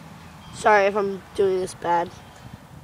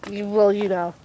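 ordinary talk

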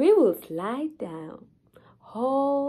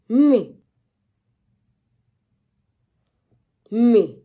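loud disbelieving cry; 12 dB louder than usual. Acted. Repeated self-questioning outburst.

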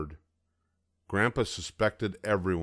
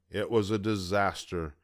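plain reading voice

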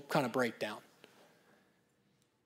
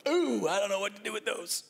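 higher, lost, overwhelmed; mock flustered parent; 4 semitones higher than usual. Parent stumped by child's feelings, mock flustered.

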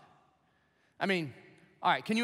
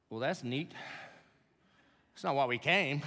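dry disappointment; 3 semitones lower than usual. Retold or imitated. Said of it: "you're probably like".

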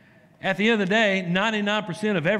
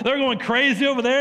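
ordinary talk, nothing marked

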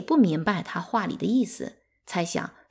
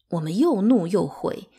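ordinary delivery

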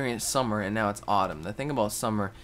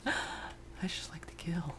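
slow, creepy whisper, higher; whispered psycho confession; creepy killer voice; 8 semitones higher, 8 dB quieter, slower than usual. Pretend. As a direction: play the unhinged axe murderer. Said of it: "I'm an axe murderer".

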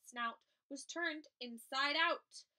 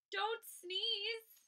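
pleading cry; 8 semitones higher than usual. Acted. Birds begging elephant.

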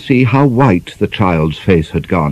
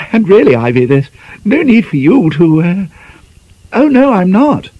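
fussing spinster; 10 semitones higher than usual. A narrator's reading. Miss Peavy fussing over Ivy's dieting, high and twittery. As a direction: fuss over the girl brightly.

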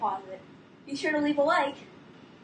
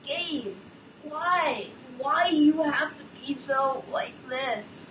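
usual speaking voice

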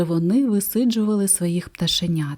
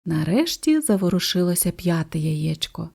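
ordinary delivery

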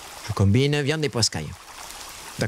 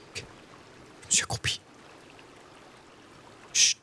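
hushed, voiceless sneaking; stealthy whisper; 11 dB quieter than usual. Real, not acted. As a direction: whisper so the prey won't hear. Sneaking, crouched, while hunting.

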